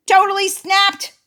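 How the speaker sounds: high, loud, punched words; dramatic climax. A narrator's reading. Narrator reaches Mister Duck's breaking point, high and loud. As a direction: build the narration to a bursting peak. Duck loses his temper.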